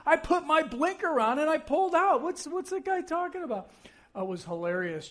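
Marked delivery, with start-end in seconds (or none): 0.1-3.7 high-pitched indignant self-justifying; worked-up retold protest; mock defensive. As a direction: re-enact protesting your innocence. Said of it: "kinda defending myself".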